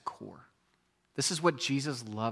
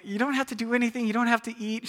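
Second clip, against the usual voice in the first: quick, higher coaxing through the door; pleading dad; 8 semitones higher than usual. Retold or imitated. Father coaxing his crying daughter to let him in. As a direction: coax the child out gently but fast. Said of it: "I'm like".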